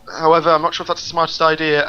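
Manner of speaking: ordinary talk, nothing marked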